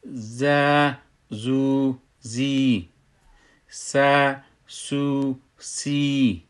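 long, drawn-out syllables, one by one; demostración de letras con madd. Shown as a demonstration. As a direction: hold each vowel long and level. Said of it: "باید درست تلفظش کنیم".

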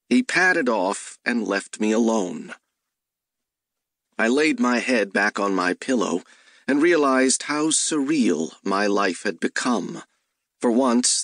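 ordinary delivery

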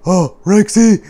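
high, loud, lively puppet voice; chatty puppet. Pretend. Puppet grumbles about Rexy's messy dishes, high lively voice. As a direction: pitch it up and chatter playfully.

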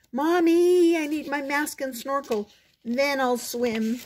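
high whining plea, loud opening call; pleading child. A narrator's reading. Froggy asking mother for mask and snorkel, pleading.

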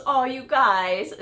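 slow, drawn-out oh, you guys; touched. Retold or imitated. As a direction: let it melt out, moved. Boss touched by the prank.

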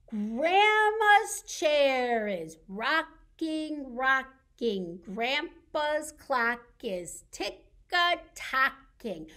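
slow chant, each beat set apart; rhythmic nursery rhyme. Pretend. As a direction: chant it steadily like a rocking chair and clock.

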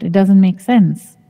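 ordinary talk

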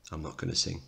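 ordinary talk

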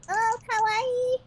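high, slow squealed kawaii; cute anime streamer. Acted. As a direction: coo like an anime girl. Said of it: "Oh my goodness".

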